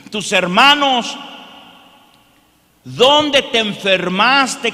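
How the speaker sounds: loud, slow, pounding sermon; fiery preaching. Acted. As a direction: preach it at full force. Preaching at full force.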